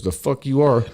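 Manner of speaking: imitated hostile brush-off; dismissive boss. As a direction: shoot the new hand down. Gruff boss rebuffs new hand.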